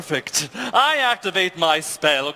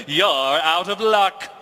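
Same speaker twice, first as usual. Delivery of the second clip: ordinary delivery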